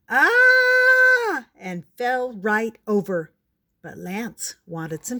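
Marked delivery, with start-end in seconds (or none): 0.1-1.5 very high, loud, long held scream. A narrator's reading. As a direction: shriek in fright and hold it. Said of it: "The lady yelled".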